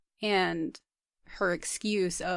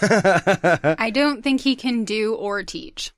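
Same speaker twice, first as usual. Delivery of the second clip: slow emphatic; 7 dB louder, much slower than usual. Real, not acted.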